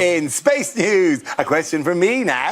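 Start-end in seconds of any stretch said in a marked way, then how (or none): 0.0-1.2 slow, drawn-out show announcement; savoured, elongated segment intro; relishing it. Acted. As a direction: stretch out each word with relish. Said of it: "I love saying this".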